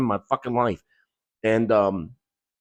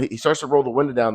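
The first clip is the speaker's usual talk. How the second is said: ordinary talk, nothing marked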